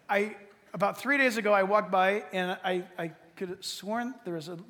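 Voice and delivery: ordinary talk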